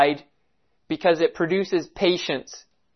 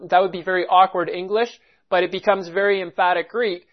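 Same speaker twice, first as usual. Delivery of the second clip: ordinary talk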